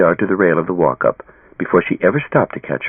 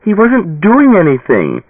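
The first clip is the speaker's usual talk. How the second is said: high, loud, slow pleading; breathless protest; 11 semitones higher, 4 dB louder, slower than usual. A narrator's reading. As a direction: defend him desperately. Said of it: "Ilyana found breath to say".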